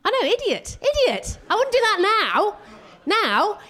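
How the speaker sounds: high, loud cry of idiot at herself; high, exasperated self-rebuke; self-mocking outburst. Real, not acted. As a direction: scold yourself loudly.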